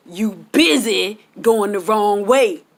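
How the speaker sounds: slow, teasing put-down; sassy comeback. Retold or imitated. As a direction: drag it out to mock him. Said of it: "Like that".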